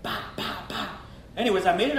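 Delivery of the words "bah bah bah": soft mock gun blasts; imitated gunshots. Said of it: "the guy's just blasting at me".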